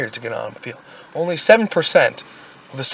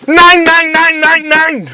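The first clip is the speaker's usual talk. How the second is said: mock German shouting; 9 dB louder, much slower than usual.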